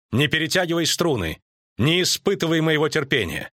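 high-pitched, imperious rebuke; restrained queenly anger, pitched high; shouted warning. A narrator's reading. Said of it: "крикнула Каланте".

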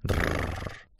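low, soft rolled engine purr; quiet motor noise. A narrator's reading. Narrator mimics motor breaking the silence, quiet whirr. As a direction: imitate a distant little motor softly. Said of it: "негромкое".

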